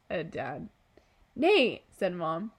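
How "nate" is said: scolding. Acted. Mom scolding Dad, laughing.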